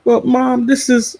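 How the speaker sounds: high, softened, hesitant explanation; retold awkward explaining. Daughter explaining a rude joke to mom, hesitant. Retells explaining to her mother.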